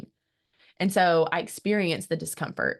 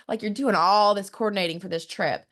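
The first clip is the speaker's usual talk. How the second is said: normal talking voice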